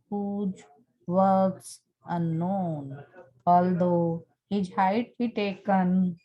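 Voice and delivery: measured classroom reading of a sonnet; poem recitation. Acted. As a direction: read the verse aloud, slowing and pausing between phrases toward the end. Reads a sonnet aloud.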